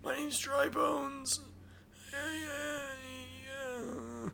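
high, dry whisper; parched rasp like a thirsty skeleton; breathless whispered croak; dehydrated voice. Pretend. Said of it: "he's, like".

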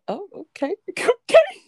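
high squeaky laughing okay; giggly high okay. Real, not acted. Laughing at sister's sign-off.

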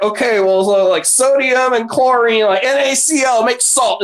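high, sing-song know-it-all tone; mock explanation. Mocking a simple chemistry formula.

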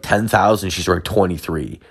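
usual speaking voice

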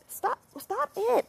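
high-pitched, slow telling-off; real high, drawn-out pet scolding; scolding the cat. Real, not acted.

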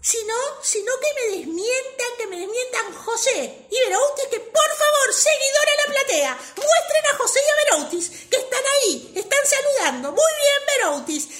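high, loud, boastful appeal to the audience; Bradford. Acted. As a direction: call out to the theatre for witnesses. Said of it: "Bradford dijo".

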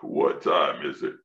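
grandma woken up, muffled; deep drowsy mask voice; groggy grandma in mask. Retold or imitated. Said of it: "CPAP mask on when she did it".

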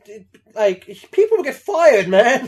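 loud, high exasperated "dude, man"; exasperated plea. Retold or imitated. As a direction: shout it at the screen, fed up. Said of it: "I'm like".

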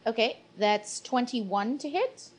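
normal talking voice

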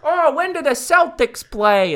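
high, slow, hammed-up Boston drawl; mock Boston sports fan. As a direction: ask it like a dopey Celtics fan. Mocking Boston local.

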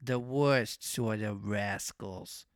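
low, very slow cat voice; cat character. A narrator's reading. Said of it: "added the cat".

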